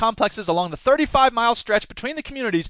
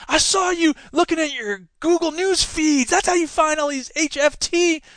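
put-on high-pitched, over-eager young heckler; easily excited youngster; 9 semitones higher than usual. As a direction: butt in as an excitable kid. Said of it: "easily excited young person".

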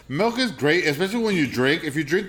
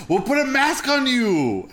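high, slow words through real laughter; laughing; 8 semitones higher, slower than usual. Real, not acted. Teasing a friend about disguising her for video, laughing. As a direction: barely get the teasing idea out while giggling. Cracking up over teasing plan.

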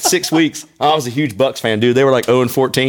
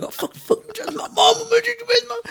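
put-on squeaky high kid's outrage, fading; mock outrage; 5 dB quieter than usual. A boy hearing his mama insulted, mock high outrage. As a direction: splutter like an offended kid. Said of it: "you get all bowed up".

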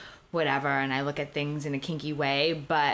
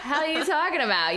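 laughing retort; 12 semitones higher, 3 dB louder than usual. Retold or imitated. Incredulous reply to a remark.

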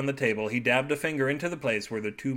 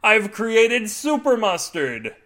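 high, loud, slow proclamation; maniacal triumph; 9 semitones higher, 7 dB louder, much slower than usual. A narrator's reading. Husband proclaiming his invention to wife, maniacal triumph. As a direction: crow like a crazed inventor. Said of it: "with a dripping maniacal grin".

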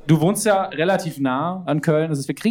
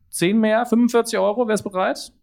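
auctioneer; 5 semitones higher than usual. Acted. Calling bids at art auction.

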